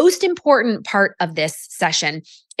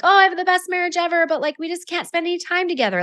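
high, fast mock gushing; gushing client; 9 semitones higher, faster than usual. Client telling coach about her marriage, mock gushing. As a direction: imitate someone gushing in a rush, pitched way up.

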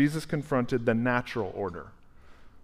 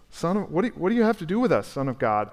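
high, louder, panicked pleading; the demons cry out in sudden panic; 5 semitones higher, 3 dB louder, faster than usual. Acted. Demons facing Jesus, panicked and pleading high. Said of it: "I think they're panicked".

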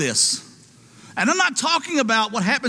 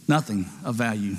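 quiet echo; 14 semitones lower, 5 dB quieter than usual. Acted.

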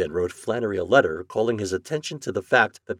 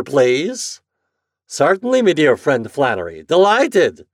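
raised, emphatic mocking musing; sardonic express agent; 5 semitones higher, 8 dB louder than usual. A narrator's reading. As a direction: act out the imagined exchange with mock politeness. Flannery muses, mimics the exchange.

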